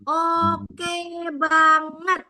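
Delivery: very slow, drawn-out "Oke banget"; ajakan berintonasi. Shown as a demonstration. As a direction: lead the group, stretching "Oke" and "banget" long. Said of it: "Yang setuju boleh katakan". ("Oke banget" dipanjangkan, mengajak semua ikut)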